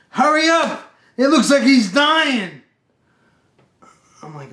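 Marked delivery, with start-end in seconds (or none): 0.1-2.6 high, loud acted cry of alarm; urgent alarm. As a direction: shout it like urgent news. Husband runs back with urgent news.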